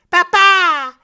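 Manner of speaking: put-on very high, loud child's call; =call for Daddy in a high, insistent child's voice; Josette. A narrator's reading. Small girl calling her father, high loud call. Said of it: "says Josette".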